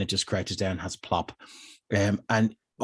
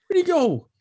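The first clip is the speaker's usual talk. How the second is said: puzzled guard. Acted. Guard searching for dog.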